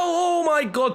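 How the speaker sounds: sudden high gasp of disbelief; shocked outburst. Real, not acted. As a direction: blurt it out, stunned by the save. Sudden great save in game.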